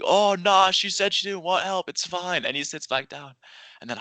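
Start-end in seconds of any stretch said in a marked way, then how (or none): 0.0-2.4 a hint of a carefree doofus, pitched higher and louder; doofus friend. Imitating his careless friend brushing off helping, offhand. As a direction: toss it off like a clueless buddy. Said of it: "sort of a doofus about it".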